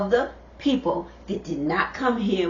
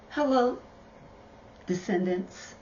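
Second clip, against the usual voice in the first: soft, drawn-back greeting to viewers; talking low; 2 semitones lower, 7 dB quieter than usual. Real, not acted. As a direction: greet them a little too quietly. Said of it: "I was probably talking a little low".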